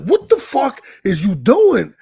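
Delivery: loud, high, drawn-out exasperated demand; imitating an exasperated coach's yell; retold angry outburst. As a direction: re-enact losing it at your fighter.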